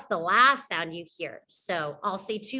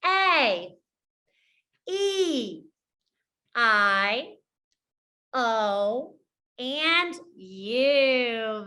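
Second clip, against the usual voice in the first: letter names one at a time, drawn out with pauses; spaced, stretched letter names; say-along vowels; much slower than usual. Shown as a demonstration. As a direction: call out each vowel slowly for learners to echo. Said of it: "say this with me".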